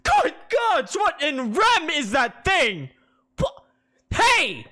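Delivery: high, loud, slowed horror; preacher jumping away from the harpy; startled outcry. Acted. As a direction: recoil from the creature. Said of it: "Conrad's eyes widen".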